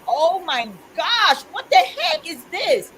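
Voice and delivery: stunned, drawn-out mock alarm; shocked realization. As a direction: relive the moment it all came flooding back. Retold moment of sudden realization.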